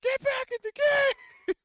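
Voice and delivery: full-force high-pitched scream. Acted. Horgus shaking a stressed soldier, screaming. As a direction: scream it like a frantic drill order.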